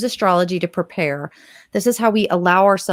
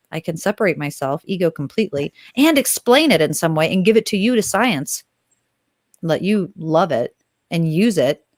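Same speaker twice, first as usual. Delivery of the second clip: normal talking voice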